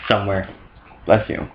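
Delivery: ordinary delivery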